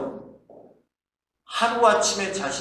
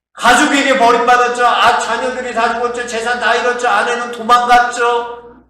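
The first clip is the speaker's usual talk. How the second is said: loud, high, insistent listing of losses; impassioned outcry; 6 semitones higher, 10 dB louder than usual. Real, not acted. Preacher hammering home Job's losses, impassioned outcry. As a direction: cry out each loss at a high, level pitch.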